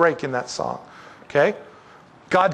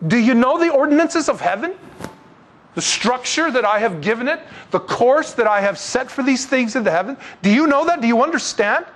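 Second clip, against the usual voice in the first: preaching God's challenge; 7 semitones higher than usual. Acted. Preacher voicing God challenging Job, high and forceful. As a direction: hammer the questions at the doubters.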